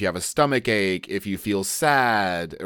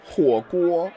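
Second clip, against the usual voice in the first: whiny answer; 9 semitones higher than usual. Acted. Acted skit answer.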